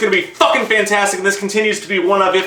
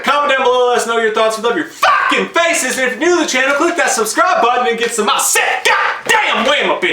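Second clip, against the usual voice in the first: hyped outro; 7 semitones higher than usual. Real, not acted. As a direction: pump up the viewers to subscribe. Outro call to subscribe.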